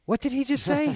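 high, loud incredulous squeal; mock astonishment. Bystander overhearing the star's line, mock astonished. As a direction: imitate a startled woman, voice shooting up. Acting out woman's startled reaction.

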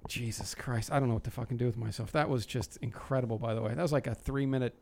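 normal talking voice

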